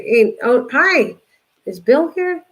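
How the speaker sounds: light up for an arriving friend; cheerful greeting. Real, not acted. Greeting a viewer arriving in chat.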